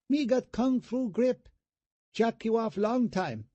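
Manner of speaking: put-on high falsetto, Vietnamese-accented come-on; falsetto hooker voice. A narrator's reading. Brother teasing brother with a dummy arm, falsetto hooker voice. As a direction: tease him in a squeaky hooker voice. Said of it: "speaking in a falsetto Vietnamese hooker voice".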